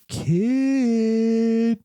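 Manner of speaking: high, drawn-out mock dog call. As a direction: stretch it out high, as if summoning a dog. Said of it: "calling him like a dog".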